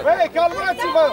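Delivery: loud call for calm; shouted order. Real, not acted. Coach to the team to calm down, shouting. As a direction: yell at them to settle.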